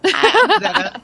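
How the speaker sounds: giggly high hello through a laugh; nervous laughing greeting. Real, not acted. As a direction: laugh it out, shy.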